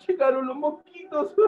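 high-pitched storyteller's alarm; mock alarm. Storyteller warning children that mosquitoes have arrived, mock alarm. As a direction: cry it out high, playing at panic.

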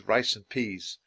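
ordinary delivery

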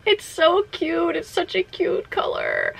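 slow, high, melting delight; adoring coo. Real, not acted. Player admiring her cute shiny, adoring coo. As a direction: coo slowly, trailing into breath. Cooing over the shiny's color.

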